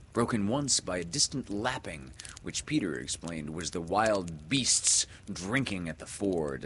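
ordinary delivery, nothing marked

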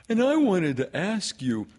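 high, loud, insistent bear; Pooh. Acted. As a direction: press the request in a piping character voice. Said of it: "said Pooh".

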